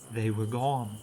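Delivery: plain reading voice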